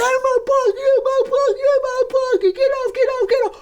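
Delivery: high, loud, frantic squeal of a beaten man; panicked begging. Retold or imitated. The beaten attacker begging the storyteller, a high panicked squeal.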